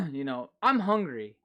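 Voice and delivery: ordinary delivery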